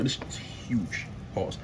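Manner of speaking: slow, hushed whisper; awed whisper. Real, not acted. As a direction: whisper it slowly in amazement. Marvels at a huge chicken leg.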